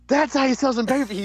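high, excited Taker boast; hyped Taker impression. Taker hyped backstage, high and excited. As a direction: crow it fired up. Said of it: "And then Taker's like".

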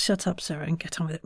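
ordinary talk

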